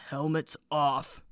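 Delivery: low, quiet, slow command; a stern guard's order. Retold or imitated.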